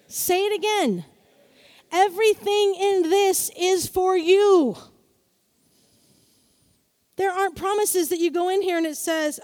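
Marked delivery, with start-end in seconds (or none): 1.9-4.8 slow, louder, every word leaned on; slow emphatic declaration. Shown as a demonstration. Preacher repeating it for the congregation, slow and loud. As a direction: drive it home for the room.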